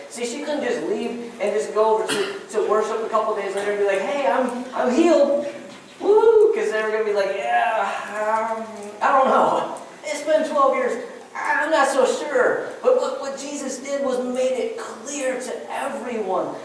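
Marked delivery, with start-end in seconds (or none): 4.0-6.5 higher, louder, ending in a high whoop; mock-celebrate good news with a woo; gleeful, hammed-up cheer; excited announcement. Imagined woman announcing her healing. 7.4-12.7 higher, drawn-out hesitation; acted reluctant disbelief, stretching the last words; skeptical doubters. Imagined doubters answering her.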